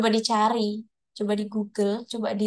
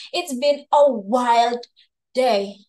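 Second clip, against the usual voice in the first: wild said slowly and louder; emphatic It's been a wild day; stressed wild day; 4 dB louder, much slower than usual. Shown as a demonstration. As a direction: lean on wild, slow and loud. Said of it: "ada intonasi penekannya".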